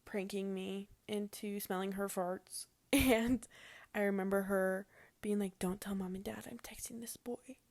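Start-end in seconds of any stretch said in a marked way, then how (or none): 0.1-2.7 hushed, secretive whisper. Real, not acted. Secret embarrassing memory. 5.6-7.6 imitating sister's conspiratorial whisper; whispered plea.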